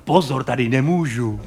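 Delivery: mouse's voice. Retold or imitated. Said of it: "říká".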